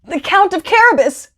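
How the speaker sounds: high, mock-frightened shout of the reapers; a touch of trembling in the shout; shaky shout. A narrator's reading. Frightened reapers answer the king, mild shaky shout. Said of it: "With shaky voices, the reapers all shouted".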